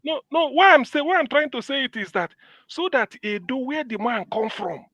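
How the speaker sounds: insistent high protest. Real, not acted. Correcting the co-host about Edo, high insistent protest. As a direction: insist over him, voice up high.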